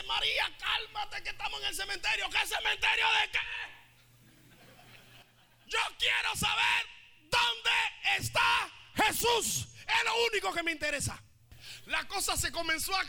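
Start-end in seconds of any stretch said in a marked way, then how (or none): 0.0-2.3 pleading call. Acted. Joana calms María. 2.3-11.2 furious shout. Acted. Mary Magdalene yelling at the women in the graveyard, furious.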